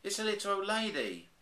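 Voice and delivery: high, startled exclaim; shocked at the discovery; surprised. A narrator's reading. Bears cry out.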